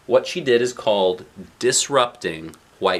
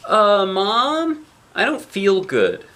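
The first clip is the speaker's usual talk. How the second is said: put-on high, louder child's voice; little girl feeling sick; 9 semitones higher, 4 dB louder, slower than usual. A narrator's reading. Girl tells her mom in the car she feels sick, high child voice. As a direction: play the queasy little girl. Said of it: "says her mother".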